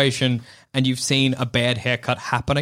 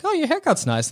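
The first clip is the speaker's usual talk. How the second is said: high fake compliment; 13 semitones higher than usual. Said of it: "I'm like".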